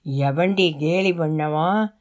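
low, slow growl of an angered father; aggressive fatherly anger. A narrator's reading. Husband overhearing that his daughter was teased, aggressive. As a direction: demand it angrily, deep and drawn out. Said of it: "ஆக்ரோஷமான குரலை".

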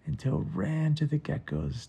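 ordinary delivery

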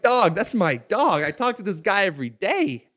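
hammed-up shocked outrage; outraged disbelief. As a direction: squeal it like you can't believe it. Outraged reaction to stolen contacts.

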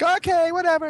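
put-on very high, bored kid voice; mock whiny kid. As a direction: whine it like a sulky child. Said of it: "I would just be like".

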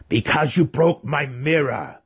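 high, petulant monster snap; snapped back. A narrator's reading. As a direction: fire it back, spiteful. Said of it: "the faceless one shot back".

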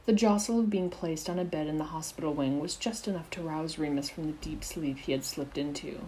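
plain reading voice

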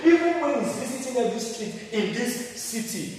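fiery building sermon; rising preaching. Real, not acted. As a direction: build the list to a peak. Preaching at full force.

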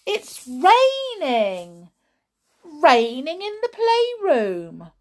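high, loud, slow cry; surprised call. Acted. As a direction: call out in amazement. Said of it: "called out in surprise".

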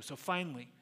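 usual speaking voice

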